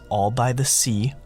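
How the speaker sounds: usual speaking voice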